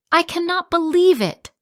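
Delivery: loud, high, disbelieving shout. Acted. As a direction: yell it out in outrage. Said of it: "I shout".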